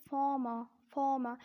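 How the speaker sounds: "former" as 'faw-muh'; both Rs silent. Shown as a demonstration. Teacher presenting an interesting word "former", both Rs silent. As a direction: drop both Rs in "former", ending on a vowel. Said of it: "keep both these R's silent".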